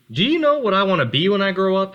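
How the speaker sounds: bursting to tell dad his big idea; excited young turtle asking fast; eager child voice. Acted. Little turtle asks his father.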